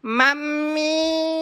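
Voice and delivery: long, drawn-out tattling call; calling for mum. Acted. Son refused the laptop, calling out for mum. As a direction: stretch it out as a threat to tell mum. Boy calls mother to tattle.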